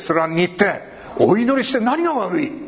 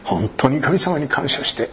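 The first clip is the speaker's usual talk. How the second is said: ordinary delivery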